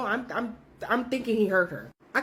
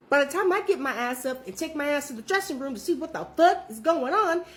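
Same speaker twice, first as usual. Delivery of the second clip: ordinary delivery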